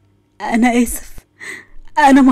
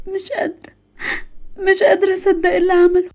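crying; 7 semitones higher, 3 dB quieter than usual. Acted. Daughter breaks down crying.

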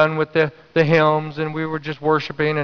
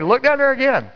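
high, pressing retold command; insistent inner voice; 11 semitones higher than usual. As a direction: voice an urgent nudge, pitched up. Inner prompting voiced, repeated urgently.

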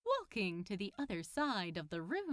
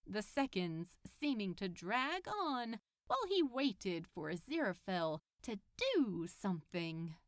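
ordinary delivery

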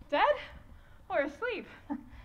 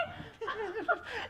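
rueful laugh; 10 dB quieter than usual. Acted. Helena laughs through her lament.

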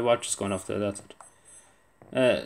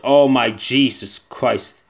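slow, loud groaning Oh my Jesus Christ; real exasperated dread; dismayed drawn-out oath; 8 dB louder, much slower than usual. Real, not acted. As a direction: stretch it out in disbelief. Sees the hard mode.

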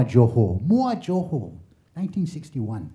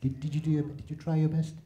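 soothing European parent; gentle parent; 7 dB quieter than usual. Retold or imitated. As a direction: ask it kindly and softly. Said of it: "Only one question they wanna know".

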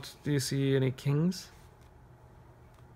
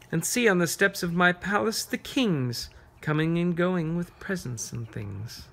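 higher, sing-song verse building to the sailors; reading a poem to children; 4 semitones higher than usual. Acted. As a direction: paint the harbor for the kids.

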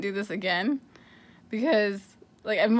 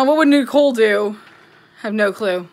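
ordinary talk

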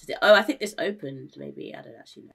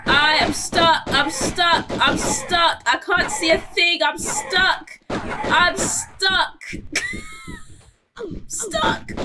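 panicked; 11 semitones higher, 7 dB louder, much slower than usual. Real, not acted. Panicking stuck in game.

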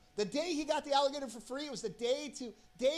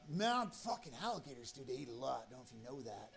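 lightly voiced drunk buddy, low and soft; drunk friend; 10 semitones lower, 8 dB quieter than usual. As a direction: sink lower and quieter, like a mildly drunk friend. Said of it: "drunk again".

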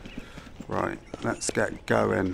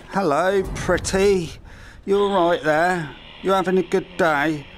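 mock sweet greeting; 6 semitones higher than usual. Teasing a zombie woman.

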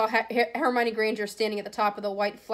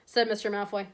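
usual speaking voice

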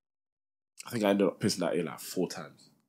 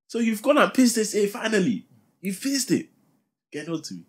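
high shrill-pitched mum voice, much higher; mum screaming; 12 semitones higher than usual. Retold or imitated. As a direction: act out mum screaming at you. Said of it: "She just screamed".

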